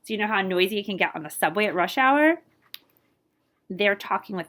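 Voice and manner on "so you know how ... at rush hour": usual speaking voice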